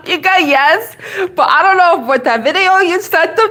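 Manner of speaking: ordinary delivery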